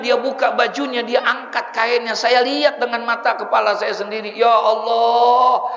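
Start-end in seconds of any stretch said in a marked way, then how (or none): 4.3-5.6 long, drawn-out, louder gasp of God; shocked exclamation. Retold or imitated. Preacher recalling the sight of the tattooed body, stunned. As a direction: stretch it out in disbelief.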